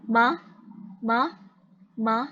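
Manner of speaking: each "ma" short with an upward glide; rising second tone. Shown as a demonstration. Tutor repeats tone two for student, rising. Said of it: "Nada dua ini dia naik ke atas".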